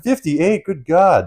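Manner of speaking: high, loud fifty-eight in horror; repeating the salesman's guess, high and loud; aghast. Retold or imitated. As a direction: spit it back in disbelief.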